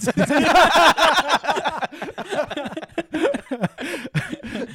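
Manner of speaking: bursting out laughing; big high laugh; loud laugh. Real, not acted. Joke about a clandestine tournament.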